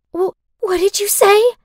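high, loud stunned question; shocked stammer. Acted. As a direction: stammer in disbelief.

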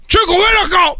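high, exclaimed summons; angry father. Acted. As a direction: call the boy over in exasperation. Angry father summoning his son. (padre enojado llamando, tono muy agudo)

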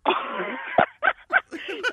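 squealing laugh of relief; high burst of laughter. Real, not acted. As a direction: shriek with laughter at being pranked. Victim laughs on learning the prank.

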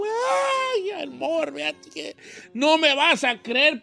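high mock sobbing plea; hammed-up husband sobbing as he begs; crying. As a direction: wail it to your wife in fake tears. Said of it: "y él llorando".

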